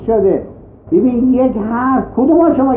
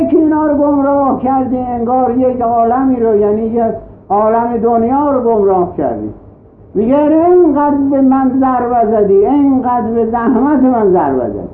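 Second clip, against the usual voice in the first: raised, earnest pulpit voice; impassioned sermon lines, a little higher; preaching God's reproach; 4 semitones higher than usual. Acted. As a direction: reproach them gently as God.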